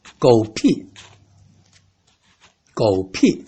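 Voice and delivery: "狗屁" said slowly and low, falling fourth tone; slow curse demo. Shown as a demonstration.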